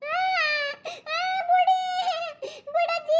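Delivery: put-on a little girl sobbing to be released; crying child. Child cries, begs grandmother.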